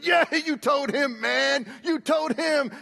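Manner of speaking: imitating the guys egging him on; cheering coworkers. Workers on the site praise his yelling, mock hearty.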